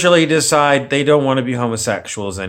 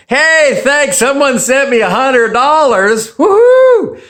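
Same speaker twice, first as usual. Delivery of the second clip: much higher, louder, gushing mock-happy whoop; over-the-top giddy "Woohoo!" in a far higher voice; mock excited thanks; 12 semitones higher, 6 dB louder than usual. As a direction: ham up a thrilled YouTuber getting cash. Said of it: "not smiling and happy and going".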